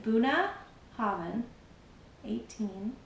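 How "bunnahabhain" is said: "Bunnahabhain" said slowly as BOO-nuh-HAH-vin with a rising end; careful pronunciation. Shown as a demonstration. Teaching viewers the whisky's name, slow careful "Bunnahabhain". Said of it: "that's how you pronounce it".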